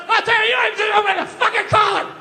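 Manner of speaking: mock furious father; dad telling off priest. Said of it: "my dad started getting his leg on".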